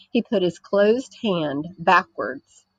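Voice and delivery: ordinary delivery, nothing marked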